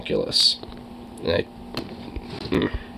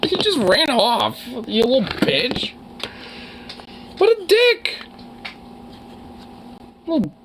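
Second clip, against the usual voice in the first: indignant whine; 13 semitones higher than usual. Real, not acted. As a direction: complain in a squeaky, wounded voice. Scolding pet that ran away.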